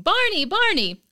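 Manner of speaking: put-on high, loud child calling; excited kid scream. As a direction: shriek it with delight.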